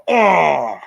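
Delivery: loud drawn-out mock "oh"; imitated pained groan. Retelling Howard's fall on his duck nuts, imitated loud groan. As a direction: cry out, imitating Howard landing hard. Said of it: "You can just hear him go".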